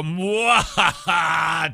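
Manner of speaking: slow loud villain mwahaha; evil laugh. Pretend. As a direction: draw out a gloating laugh. Host gloats at his co-hosts.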